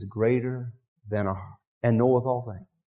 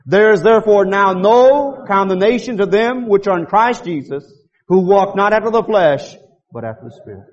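reading aloud together; 7 semitones higher, 7 dB louder than usual. Shown as a demonstration. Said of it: "Let's do it one more time".

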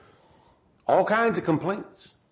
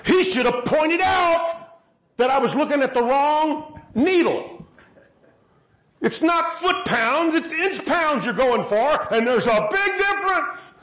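lecturing God; 6 semitones higher than usual. Retold or imitated. Voicing what God should say.

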